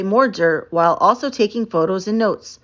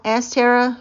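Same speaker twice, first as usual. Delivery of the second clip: ordinary delivery, nothing marked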